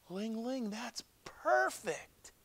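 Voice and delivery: high, drawn-out delight; thrilled, high-pitched realization; excited exclamation. Acted. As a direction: exclaim it as a sudden revelation, without much volume. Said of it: "He shouted and he rushed home".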